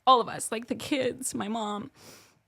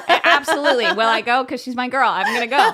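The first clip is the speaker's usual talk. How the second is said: louder, laughing, all in; laughing eager yes; 4 semitones higher, 9 dB louder than usual. Real, not acted. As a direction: bubble over with an eager yes.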